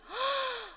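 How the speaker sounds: sharp awed intake of breath; gasp of realisation. Acted. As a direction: gasp as the humbled king. King's realisation. (깨닫고 헉 숨 들이쉬기)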